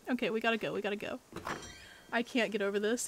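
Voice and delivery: ordinary delivery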